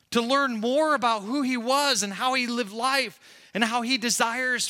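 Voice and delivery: high, loud, driving sermon delivery; impassioned preaching. Real, not acted. Preaches at full force.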